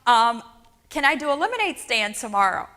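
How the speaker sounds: put-on pleading child asking mom for a doll; a hint of a young girl's plea; eager little girl. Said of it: "I'm like".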